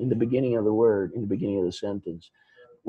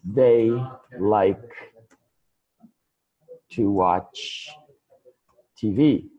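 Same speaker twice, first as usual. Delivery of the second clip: slow teaching demo; 3 semitones higher, slower than usual. Shown as a demonstration. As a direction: spell the question out word by word for learners. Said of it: "I don't know, something like that".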